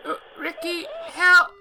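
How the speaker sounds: put-on high girl's voice, loud sudden scream of help; high desperate scream; desperate cry. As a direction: scream for help as the line dies. Cood's last cry on phone.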